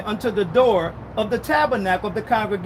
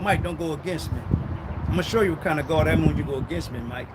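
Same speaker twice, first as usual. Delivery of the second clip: low warning; 8 semitones lower, 5 dB quieter, faster than usual. Acted. God warning Mike, low.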